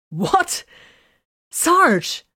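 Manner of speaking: a startled cry of her sergeant's title; exclaiming. A narrator's reading. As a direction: burst out in shock. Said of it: "Vox exclaimed".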